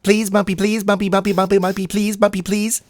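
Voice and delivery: high whiny begging, over and over; pestering child. Acted. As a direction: nag like a little kid.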